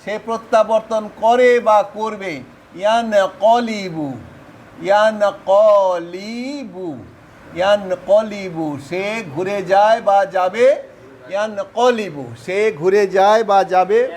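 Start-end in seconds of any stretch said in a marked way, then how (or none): 2.7-8.8 slow drill. Shown as a demonstration. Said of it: "teacher drills the verb form".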